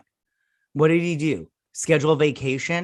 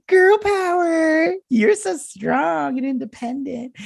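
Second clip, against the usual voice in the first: sarcastic cheer; 13 semitones higher, 4 dB louder, slower than usual. Real, not acted. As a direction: cheer it with heavy sarcasm, way up high. Sarcastic cheer for female empowerment.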